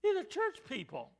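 high, loud preaching in short bursts; forceful preaching. Real, not acted. Preacher dismayed at what church people believe, forceful preaching. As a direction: ring each phrase out, with long pauses between.

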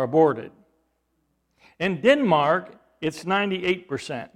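high, loud pulpit cry; impassioned preacher's challenge; full-force preaching. Acted.

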